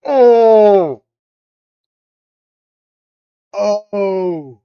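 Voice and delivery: loud startled oh; surprised shout. Real, not acted. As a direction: blurt it out in shock. Sudden realisation shouted.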